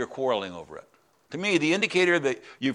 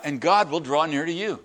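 ordinary talk

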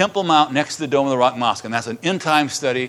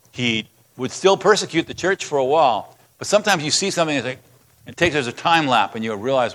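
ordinary talk, nothing marked

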